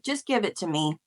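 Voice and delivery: soft, pleading prayer to God; hushed prayer. Retold or imitated. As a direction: beg quietly under your breath. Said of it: "I would pray to God".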